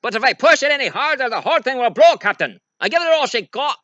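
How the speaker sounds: high, fast, frantic engineer; hammed-up Scottish engineer panic; Scotty. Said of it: "that's my Scotty impression".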